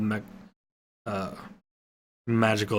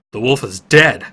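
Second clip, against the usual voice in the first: declared; 11 dB louder than usual. A narrator's reading. Realizing wolf is dead.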